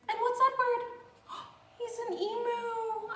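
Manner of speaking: high gasping delight; excited reveal. Pretend. Reader building excitement for the children, high and gasping. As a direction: gasp it like a surprise.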